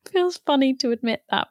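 laughing, a touch embarrassed; bashful laugh over the words. Real, not acted. Laughing at admitting proud tear.

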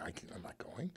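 breathy whisper of a mock-secretive refusal. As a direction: whisper it like a confession. Retells brother declining the invitation.

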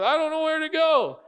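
put-on a five-year-old giving up; hint of a lost small child. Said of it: "the kid comes back and goes".

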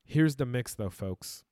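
ordinary delivery

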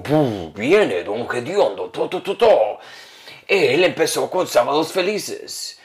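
slow, drawn-out mock announcer; recorded intro voice. Guest mimics the comedian's recorded show intro, mock announcer. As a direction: imitate a show's recorded introduction, stretching the words. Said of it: "pone una voz, una grabación como".

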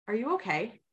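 soft, quiet caring question; gentle question. Retold or imitated. Softly asking her inner voice if it is okay. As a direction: ask gently, reaching out. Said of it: "I said".

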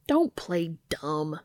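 slow, gruff and teasing. Acted. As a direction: tease him slowly, like a gruff boss. Bobby teasing Dean.

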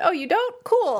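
high, airy imitation of a chill heroine; mock carefree girl. Imitating a laid-back heroine to her cohost, lightly high. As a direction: toss it off high and easy. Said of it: "and she's like".

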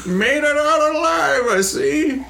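very high, slow little voice; bottled character voice. Acted. Stimley in his bottle to surviving adventurers, high-pitched and slow. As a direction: squeak it out slowly.

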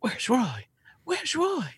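very high, breathy falsetto calling Roy; [name] voice. Retold or imitated. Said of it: "you start hearing [name] say".

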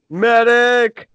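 loud mock call for a medic; man down shout. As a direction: yell for a medic like a soldier. Calling for help, joke.

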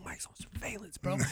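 hushed breathy aside; whisper. Retold or imitated. Viewer watching a murder show whispers the reveal, hushed. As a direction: whisper it like a secret to the TV. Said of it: "I'm like".

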